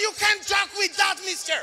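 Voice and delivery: hammed-up angry airport policeman; stern officer. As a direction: scold him like a strict security officer. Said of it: "Il m'a dit".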